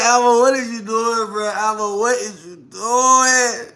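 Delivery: high, slow incredulous question; disbelief. Retold or imitated. Retelling her reaction to him scratching, incredulous. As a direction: stretch it out in disbelief.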